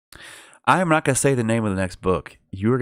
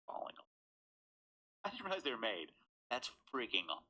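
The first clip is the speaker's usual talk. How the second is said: much softer retake; quiet repeat; 3 semitones higher, 19 dB quieter, much faster than usual. Real, not acted. A reader repeating his point much more softly. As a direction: say it again under the breath. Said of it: "She's falling all over shit".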